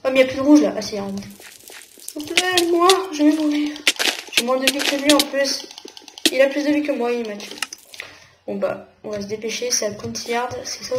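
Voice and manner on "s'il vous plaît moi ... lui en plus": high, louder plea for mercy; pleading. Real, not acted. Player begging as he's about to die in game, pleading.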